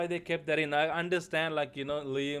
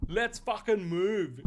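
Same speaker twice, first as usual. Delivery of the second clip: mock Australian accent, higher, slow and drawled; mocking Aussie line; 6 semitones higher, 5 dB louder, slower than usual. Streamer mocks Liam's viral line in a hammed-up Australian accent. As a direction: ham up an Australian action-hero delivery in a mock Australian accent. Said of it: "where Liam Hemsworth is like".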